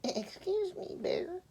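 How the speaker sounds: high hushed little sheep; timid sheep. Acted. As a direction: ask ever so gently as the sheep. She said.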